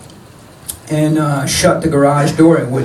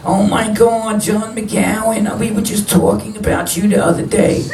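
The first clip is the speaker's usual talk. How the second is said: lightly voiced, higher, gushing older woman; mock her fake delight, pitched up; imitating a gushing old woman; 7 semitones higher than usual. Former foster mother greeting John at the door, mock gushing higher voice. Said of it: "was like".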